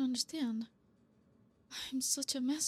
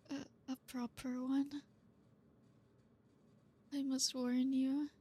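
normal talking voice

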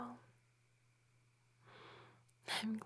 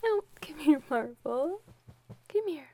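higher, loud call to a pet; calling the dog; 5 semitones higher, 6 dB louder than usual. Acted. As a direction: call the dog over brightly.

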